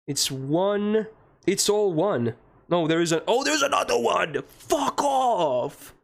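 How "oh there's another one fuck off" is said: exasperated outburst. Real, not acted. Fed up with another seal.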